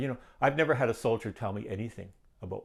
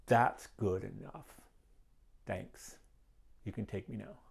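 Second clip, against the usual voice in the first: slow, soft surrender murmured upward; a slow whispered thank-you to God; quiet prayer to God; 6 dB quieter, slower than usual. Retold or imitated. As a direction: hand it over to God in a hush. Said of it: "I said to God".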